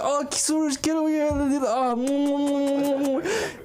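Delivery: very high, gushing grandpa impression; smothering grandfather. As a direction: squeal with affection, then a long high kissing hum. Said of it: "he comes at me. He's like".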